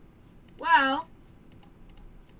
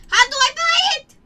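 pleading shout; 11 semitones higher, 10 dB louder than usual. Real, not acted. Begging the game to sell.

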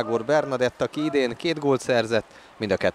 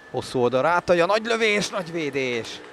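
excited commentary; 7 semitones higher than usual. Real, not acted. Shot and save.

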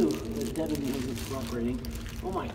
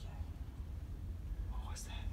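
whispering; 16 dB quieter than usual. Real, not acted. Hearing noises in tunnel.

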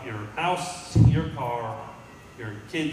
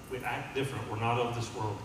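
usual speaking voice

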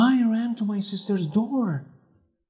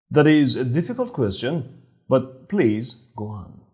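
low, deeper detective voice; Holmes; 7 semitones lower than usual. A narrator's reading. Holmes questioning Helen about her locked door, low and firm.

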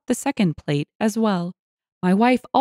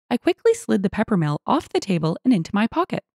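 ordinary delivery, nothing marked

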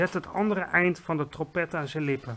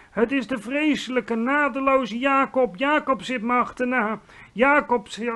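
high, loud, terrified ranting; bellowing; 6 semitones higher, 5 dB louder than usual. A narrator's reading. Nightmare-ridden giant raves about Jacob, high loud bellowing. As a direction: wail each dread out at the top of your voice. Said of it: "bulderde de vleeslapeter".

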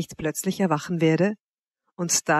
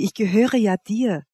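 usual speaking voice

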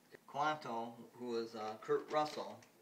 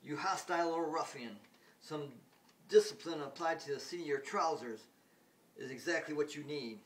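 louder, higher, sneering insult; pompous Smith; 4 semitones higher, 6 dB louder than usual. Retold or imitated. Smith scolds the alien boy, loud and pompous. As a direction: deliver a haughty scolding. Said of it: "then Smith goes".

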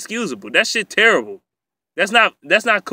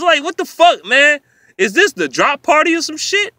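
much higher and louder, fed-up outburst; exasperated outburst; 10 semitones higher, 4 dB louder than usual. Real, not acted. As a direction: throw up your hands at the dropped passes. Ranting about receivers dropping passes.

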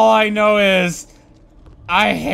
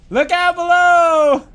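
playful call; 8 semitones higher than usual. Real, not acted. Gamer calling to helpers below. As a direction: call out a cheeky warning.

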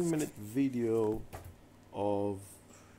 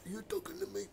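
Al Pacino impression; 8 semitones higher, 9 dB quieter, faster than usual. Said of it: "Or Al Pacino".

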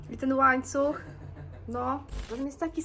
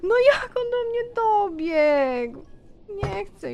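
high, slow, near-crying complaint; shaken whimper; 7 semitones higher, 7 dB louder, much slower than usual. Real, not acted. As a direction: whine in real shock. Upset after losing the game.

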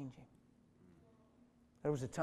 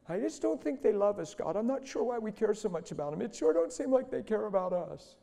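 high, self-pitying parody of complaint, building louder; hammed-up grumbling to God, growing more aggrieved; mock-complaining Jesus; 8 semitones higher, 8 dB louder than usual. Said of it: "like Jesus sitting on the Mount of Olives on a rock saying".

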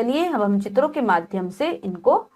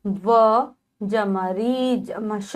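slow spelling; much slower than usual. Shown as a demonstration. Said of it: "teacher spells word parts".

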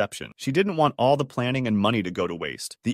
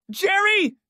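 high, loud yell of his name; shouting; 5 dB louder than usual. A narrator's reading. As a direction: shout it across the room. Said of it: "Karen shouted".

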